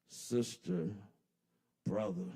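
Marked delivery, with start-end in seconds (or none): none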